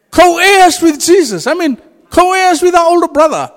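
high, loud preaching voice; fervent preacher in full flow; full-force preaching. Acted. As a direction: proclaim it to the whole hall.

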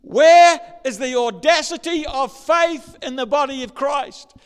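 loud, high, slow preaching; loud, high, slowed-down sermon challenge. Real, not acted. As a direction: call the crowd out on their faith.

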